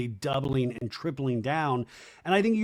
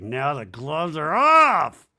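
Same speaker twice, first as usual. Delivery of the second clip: mock ominous; 7 dB louder, slower than usual. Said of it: "Janet's like".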